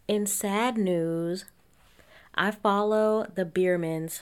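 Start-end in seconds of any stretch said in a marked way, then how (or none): none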